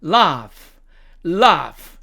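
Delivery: British "laugh" with long ah; British demo of the word "laugh"; British accent. Shown as a demonstration. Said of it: "영국식 발음으로 가면".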